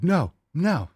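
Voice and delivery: high, mock-firm dog correction; retold scolding. Owner telling a dog off, retold scolding.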